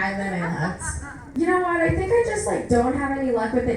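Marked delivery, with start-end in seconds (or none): none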